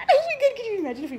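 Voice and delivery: high, loud fangirl squeal; excited squeal. Real, not acted. Imagining [name] walking by, excited squeal. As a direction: squeal it, totally starstruck.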